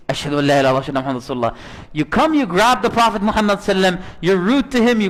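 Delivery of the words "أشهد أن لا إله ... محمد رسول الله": أشهد أن لا إله إلا الله gabbled very fast and low; rushed mock. [name] incredulously rattling off the man's shahada, rushed. [name] mocks the sudden conversion.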